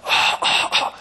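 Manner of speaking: airy, toneless "아, 아" with no voice; voiceless rasp. Retold or imitated. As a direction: show the lost voice with a breathy 아. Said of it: "소리가 하나도 안 나와요".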